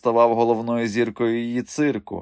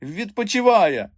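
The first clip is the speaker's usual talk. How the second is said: high, slow nervous answer; trembling voice; 11 semitones higher, 3 dB louder, slower than usual. A narrator's reading. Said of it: "тремтячим голосом відповів Піня". (тремтячий наляканий голос)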